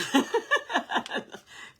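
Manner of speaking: breathy laugh, high and loud; airy high laugh. Real, not acted. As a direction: let out a big airy laugh.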